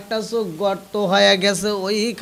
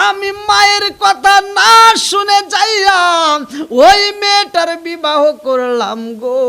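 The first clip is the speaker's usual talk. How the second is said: high, loud chanted confession; impassioned preaching; 9 semitones higher, 9 dB louder than usual. A narrator's reading. Preacher confesses marrying against mother's word, impassioned preaching. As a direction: cry it out in sermon chant, easing toward the end.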